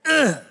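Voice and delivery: short high-pitched "ugh"; mock groan of exasperation; disgusted groan. Reacting to a frustrated customer's complaint, groaning.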